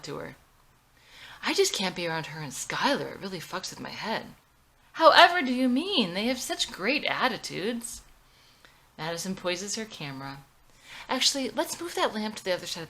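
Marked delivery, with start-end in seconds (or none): 4.9-8.0 much higher, louder prissy mock tone; fake-innocent sarcasm; sarcastic mock sweetness. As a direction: sneer by sounding sugary. Sarcastic jab at mom and sister.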